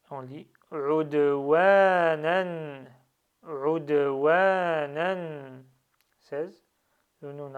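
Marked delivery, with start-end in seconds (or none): 0.7-2.9 "udwanan" chanted slow and loud, ending on n; silent final alif. Shown as a demonstration. Teacher reads tanwin alif as silent, "udwanan" ending in n. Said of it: "du coup il ne se prononce pas". 3.4-5.6 "udwanan" chanted slow and loud, ending on n; silent final alif. Shown as a demonstration. Teacher reads tanwin alif as silent, "udwanan" ending in n. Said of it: "du coup il ne se prononce pas".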